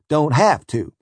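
ordinary delivery

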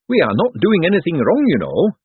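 high, anxious boffin voice; jumpy inventor, over-keen to reassure; awkward eager economist. Acted.

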